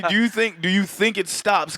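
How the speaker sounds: ordinary talk, nothing marked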